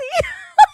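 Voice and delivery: high loud laughing squeal; shrieking laugh. Real, not acted. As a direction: burst into a shrill cackle.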